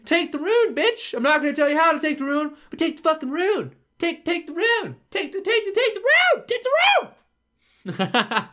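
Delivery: high shrill mocking rant; mocking the skull. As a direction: parody the nagging hint-giver. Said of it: "Or, or fucking".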